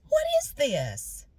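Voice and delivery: slow, high, incredulous; disbelief. Retold or imitated. Clicking through analytics, stunned at the numbers. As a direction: stretch it out in outrage. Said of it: "That's my version of panicking".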